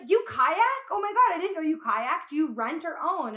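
high, loud gushing friend; excited. Retold or imitated. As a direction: gush like a thrilled friend. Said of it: "And then she's like".

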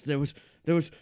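usual speaking voice

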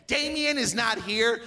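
bossy manager impression. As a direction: call out an order as the imitated boss. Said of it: "he comes over and he goes".

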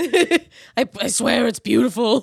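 mock-congested pleading; mimicked cold voice. Host finishing the riff about the pretty voice, mock congested. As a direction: swear it through a pretend blocked-up cold. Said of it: "This isn't what I sound like".

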